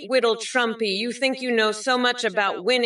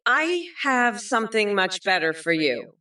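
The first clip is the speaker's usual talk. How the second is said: normal talking voice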